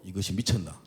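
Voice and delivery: low, soft muttered thought; inner thought. Retold or imitated. Pastor thinking to himself at the offered drink, low murmur. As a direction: say it under your breath. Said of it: "내가 속으로".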